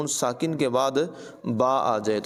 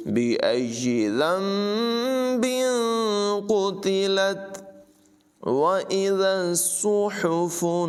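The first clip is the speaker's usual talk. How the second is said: slow chanted tajweed recitation; Quran recitation; 5 semitones higher, much slower than usual. Shown as a demonstration. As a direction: recite it melodically, drawing out the vowels. (धीमी, सुरीली क़ुरान तिलावत)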